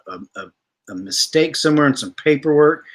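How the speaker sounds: ordinary talk, nothing marked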